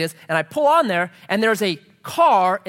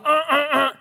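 high, repeated distressed "uh"; imitated agitated grunts; 9 semitones higher, 3 dB louder than usual. Pastor showing the agitation she stopped, imitated grunts. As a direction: mimic frantic wordless whimpering. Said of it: "being all".

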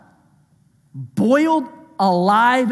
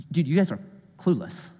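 lower, softer, deadpan dismissal; understated rebuke; 4 semitones lower, 6 dB quieter than usual. Acted. Jesus answering clueless disciples, understated rebuke. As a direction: say it low, as a weary put-down.

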